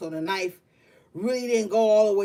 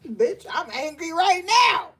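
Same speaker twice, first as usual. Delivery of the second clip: higher, louder fake fury that breaks into laughter; laughing mock anger; 8 semitones higher, 4 dB louder than usual. Telling the gang off, a pretend outburst that dissolves into laughing. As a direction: pretend to be mad but crack up mid-line. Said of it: "I'm not angry, gang, but I had to say that".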